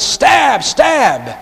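high shouted stab stab; mock battle cry. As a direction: yell it charging in. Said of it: "he went".

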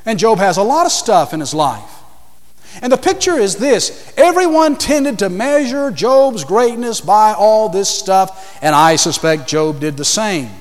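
usual speaking voice

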